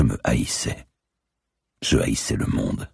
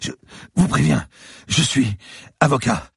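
much higher, louder panicked man; a beaten lawyer gasping in fear; frightened bleeding man pleading with the cop; terrified captive; 4 dB louder than usual. Acted. Lawyer threatens while panicking.

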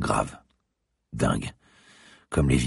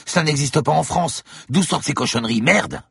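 high, rising panic ending in a curse; panicked; 12 semitones higher than usual. Acted. Del Piero panicking about the infestation. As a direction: lose control, pitch shooting up. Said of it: "Paniqua Del Piero".

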